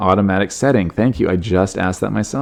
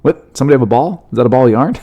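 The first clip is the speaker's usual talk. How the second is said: mock startled cat officer, higher; distracted cat-cop; 5 semitones higher, faster than usual. Imagined sergeant at the briefing, mock distracted cat. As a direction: play a cat spotting yarn. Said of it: "she started going".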